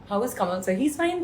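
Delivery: normal talking voice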